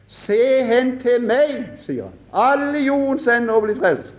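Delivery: high, slow preacher's call; Methodist preacher calling. Retold or imitated. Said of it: "ropte han bare som en metodist kunne rope".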